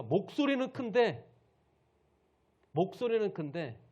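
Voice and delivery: loud, high emphatic preaching. Shown as a demonstration. Preacher describes loud but powerless speech, loud voice.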